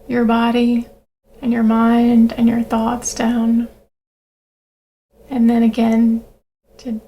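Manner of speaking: calm breathing-exercise voice with long pauses; guided meditation. Acted. Leads a grounding breathing meditation.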